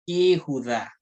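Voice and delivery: Yehudá sounded as "kihudá", vowel changed to i; demonstrated pronunciation. Shown as a demonstration. Teacher showing the prefixed word, Yehudá as "kihudá". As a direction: say Yehudá carefully with "ki" in front. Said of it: "Ella se va a pronunciar".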